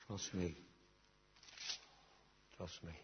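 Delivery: very soft, low, almost breathed; the Lord's gentle voice. Acted. The Lord tenderly reassuring the listener, very softly. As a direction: whisper the Lord's comfort. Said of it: "The Lord is simply saying to you".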